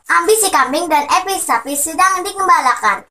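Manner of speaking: ordinary delivery, nothing marked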